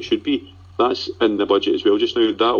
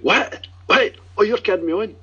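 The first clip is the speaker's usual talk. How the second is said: much higher, squeaky disbelieving What, What; imitating wife's surprise; 12 semitones higher than usual. Husband retells wife's reaction to Clint Hill news, high surprised squeal. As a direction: mimic the wife's shocked delight at the news. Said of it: "And she went".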